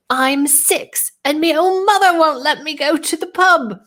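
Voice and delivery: high-pitched mock Irish little girl; horrible Irish accent. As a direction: put on a bad Irish accent as a sassy six-year-old. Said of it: "which is a horrible accent".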